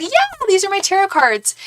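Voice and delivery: very high, acted sweet answer; chirpy reply. Teller replies to admiring friends, high chirpy voice. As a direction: act out a chirpy thank-you.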